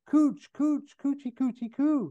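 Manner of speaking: very high coochie-coo cooing; nanny's baby talk. Acted. Nanny leaning over a crib, high baby talk. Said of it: "leans over a crib and says".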